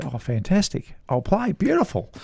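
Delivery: delighted. Retold or imitated. Accepting invite to play Augusta.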